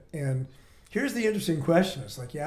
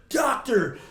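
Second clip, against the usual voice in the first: frustrated shout; 9 semitones higher than usual. Retold or imitated. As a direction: shout it out in despair in the car. Crying, hitting the steering wheel.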